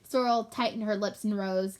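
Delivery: ordinary delivery, nothing marked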